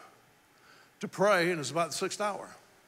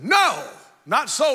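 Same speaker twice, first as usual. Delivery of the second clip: Peter protesting; 8 semitones higher, 11 dB louder, slower than usual. Acted. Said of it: "Peter said".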